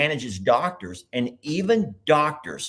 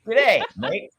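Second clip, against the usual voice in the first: trying Australian; 6 semitones higher, 4 dB louder than usual. Pretend. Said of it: "we're gonna try to repeat it".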